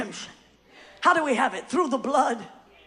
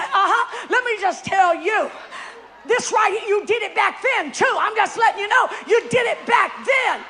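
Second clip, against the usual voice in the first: mock accuser; 6 semitones higher, 4 dB louder than usual. Accuser mocking the sinner.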